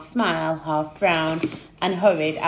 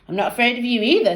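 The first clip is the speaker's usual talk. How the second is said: defiant; 5 semitones higher, 4 dB louder, much faster than usual. A narrator's reading. Standoff with Jeff.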